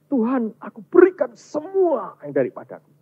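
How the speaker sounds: mock grand vow to God, pitched high; glib pledge. Believer glibly offering God everything, mock grand high vow. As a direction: promise everything too easily, voice lifted high. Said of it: "kalau kita ngomong begitu gampang".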